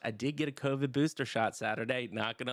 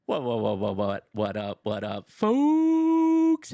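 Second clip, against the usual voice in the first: loud drawn-out hype greeting; hype shout; 10 dB louder, slower than usual. Real, not acted. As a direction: call out to the crowd, stretching it. Opening call to the audience.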